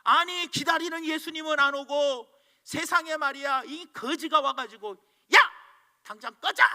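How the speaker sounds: high, loud, furious yelling; angry shout. Retold or imitated. The cobbler yells at the beggar at his door, loud and angry. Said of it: "이 할아버지가 화가 났어요".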